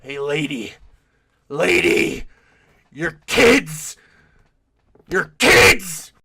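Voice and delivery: loud, rough yell at the mother; angry man yelling. Retold or imitated. Man on the plane yelling at the mom, loud and rough. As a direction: bellow it, finger pointed. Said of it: "he turns around and is like".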